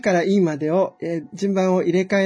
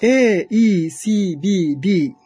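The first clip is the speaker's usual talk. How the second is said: dictating; 6 dB louder, much slower than usual. Shown as a demonstration. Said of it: "書き取ってみてください".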